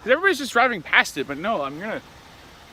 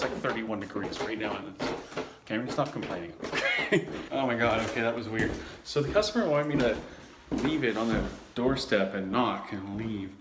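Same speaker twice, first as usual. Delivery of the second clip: normal talking voice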